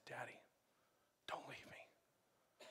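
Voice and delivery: slow whispered plea to a heavenly father; whispered prayer. Pretend. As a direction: breathe it out like a child clinging on.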